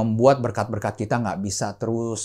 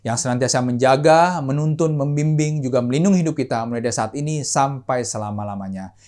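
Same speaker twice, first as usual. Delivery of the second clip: solemn blessing over raised hands; benediction; 3 semitones higher, 5 dB louder than usual. Acted. Pastor blessing the congregation, solemn benediction. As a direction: bless them as they lift their hands.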